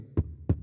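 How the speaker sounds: voiceless popped P sounds; beatbox lip kick. Shown as a demonstration. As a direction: pop the lips like a kick drum. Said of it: "not saying the word. You're just going".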